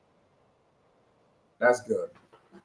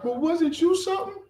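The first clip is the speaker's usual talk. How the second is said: much higher mocking voice; mimicked objector; 14 semitones higher than usual. Preacher voicing someone who challenges his own past, high mimicry. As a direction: put on a high-pitched challenger's question.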